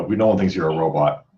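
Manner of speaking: normal talking voice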